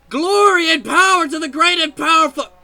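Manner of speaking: normal talking voice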